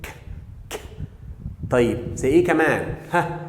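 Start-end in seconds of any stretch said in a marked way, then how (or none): 0.0-0.8 "ك" as a short, isolated K; hard K sound. Shown as a demonstration. Teacher showing how final C sounds, isolated hard K. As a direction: pop out "ك" on its own, twice. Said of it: "يبقى جت في الاخر".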